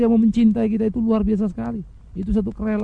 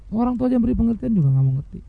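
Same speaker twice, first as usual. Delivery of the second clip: usual speaking voice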